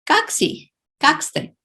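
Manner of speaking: "Как си? Как сте?" asked high, bright question tune; high clear question demo. Shown as a demonstration. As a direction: model the questions for students. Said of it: "Here it's a new phrase".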